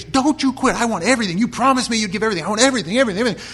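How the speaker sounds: a coach shouting right in his worn-out player's face; coach yelling. Retold or imitated. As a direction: scream at him not to quit. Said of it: "he's right in the kid's face".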